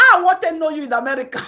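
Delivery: quick loud mocking shout; loud fast retort. Real, not acted. Shouting back at the boastful commenter, fast.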